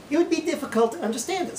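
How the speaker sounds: ordinary talk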